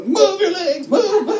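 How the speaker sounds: high, loud commanding prayer; spiritual warfare command. Retold or imitated. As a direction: shout at the legs to move like a fervent prayer warrior. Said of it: "somebody over here is doing spiritual warfare".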